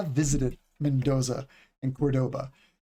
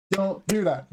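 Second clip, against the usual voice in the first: usual speaking voice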